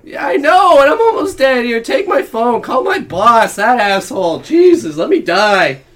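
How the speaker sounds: loud dying plea. Acted. Dying character cries out.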